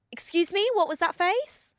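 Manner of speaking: put-on high-pitched, indignant Indian-accented woman. Stranger confronts her from behind, Indian accent. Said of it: "I can put on this accent".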